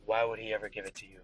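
low, weary general; tired soldier. Acted. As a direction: sigh through a long shift. GM plays tired Vega.

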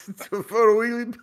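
laughing high through the name; barely holding in the laugh; giggling. Real, not acted. Giggling at his own joke.

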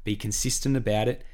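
ordinary talk

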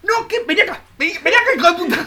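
high-pitched, hammed-up angry yell; acted furious summons. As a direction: call them over as if for a beating. Acted fury at whoever woke him.

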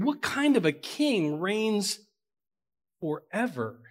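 a hint of Mary's awed question; Mary wondering. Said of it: "Mary's wondering".

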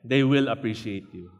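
usual speaking voice

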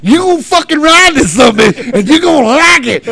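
high, full-force angry yelling; furious shouting. Retold or imitated. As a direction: rage at the boy like a fed-up father. Retold furious order to son.

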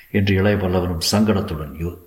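ordinary delivery

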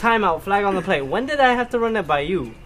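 retelling how he stopped his mom mid-scold, hammed-up protest. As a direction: throw a mock referee flag on her. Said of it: "And I was like".

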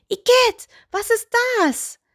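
very high, disgusted doll shriek; horrified cry. A narrator's reading. Doll jumps back at the sight of the toe, horrified. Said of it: "Entsetzt machte sie einen Sprung zurück und rief".

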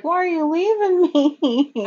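high, slow mock whine; imitated forlorn dog. Dog swimming after his departing boy, hammed-up high plea. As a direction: whine it long and high, playing abandoned. Voicing abandoned dog's thoughts.

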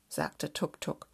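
plain reading voice

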